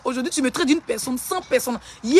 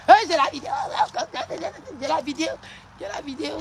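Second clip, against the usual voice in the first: mocking imitation; 3 semitones higher than usual. Mimicking a rival's repeated boast.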